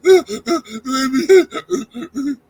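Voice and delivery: put-on loud high ooh ooh baby; mock crowd hooting. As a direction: hoot it out, teasing.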